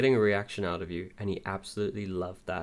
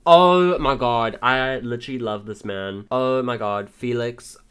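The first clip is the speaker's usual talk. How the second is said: loud, slow, drawn-out gush; swooning squeal; 3 semitones higher, 11 dB louder, slower than usual. Real, not acted. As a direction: gush like a starstruck fan. Swooning over a handsome character.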